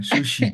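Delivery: "sushi" with a hushing sh; sh sound. Shown as a demonstration. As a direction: show the English sh in "sushi". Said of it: "Estás haciendo como el she".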